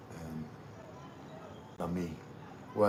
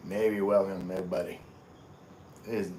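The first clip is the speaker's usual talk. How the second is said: ordinary talk, nothing marked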